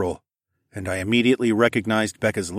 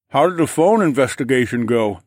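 loud, raised question through food; talking while eating, pitched up; mouth full; 4 semitones higher, 5 dB louder than usual. A narrator's reading. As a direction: ask through a mouthful of pasta. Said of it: "I asked through a mouthful of pasta".

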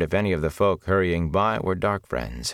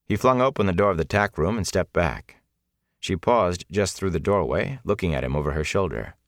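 usual speaking voice

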